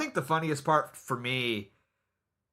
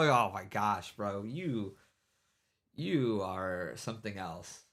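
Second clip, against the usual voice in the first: ordinary delivery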